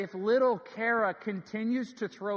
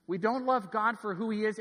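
ordinary delivery

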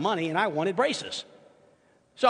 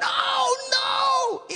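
much higher, shrieking cry of dismay; horrified scream. Retold or imitated. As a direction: scream it in horror at the mirror. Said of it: "And I smiled and I screamed".

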